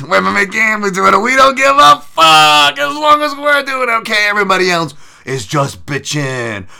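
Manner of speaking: loud, high-pitched satirical rant; mock gambling bro. Mocking the gambling crowd as a smug bro, loud and high.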